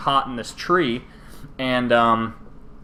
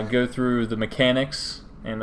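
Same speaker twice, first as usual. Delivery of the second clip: ordinary delivery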